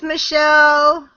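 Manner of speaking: ordinary talk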